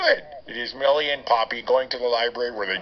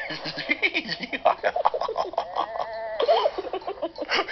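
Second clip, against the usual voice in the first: high squealing giggles; 4 dB quieter than usual. Acted. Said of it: "Suddenly I have the giggles".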